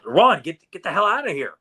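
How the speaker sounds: loud, high rush to get him out; mock hero yelling run; urgent warning yell. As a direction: shout it at the kid to flee. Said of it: "He-Man just tells Sam".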